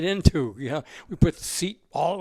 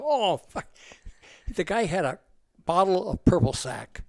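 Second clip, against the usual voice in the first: ordinary talk, nothing marked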